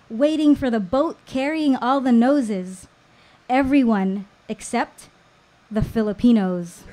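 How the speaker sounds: ordinary delivery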